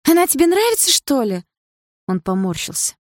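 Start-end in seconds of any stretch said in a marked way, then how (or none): 0.1-1.4 high, loud incredulous question; shocked jealous girlfriend; jealous challenge. Acted. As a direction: demand an answer in a high voice.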